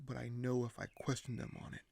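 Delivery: ordinary talk, nothing marked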